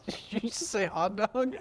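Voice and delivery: high shrieking laughter; laughing. Real, not acted. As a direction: laugh out the question. Bursts out laughing at hot dog.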